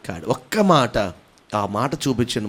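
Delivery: ordinary talk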